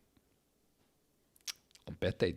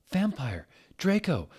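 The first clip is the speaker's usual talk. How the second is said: shocked question; 10 semitones higher than usual. Acted. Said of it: "I asked".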